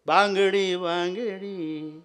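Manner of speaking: बांगडी called out loud, then बांगडी drawn out very slowly; hawker's call. Shown as a demonstration. Storyteller demonstrating the bangle-seller's cry to listeners. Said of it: "निराळ tone आहे ना त्याला".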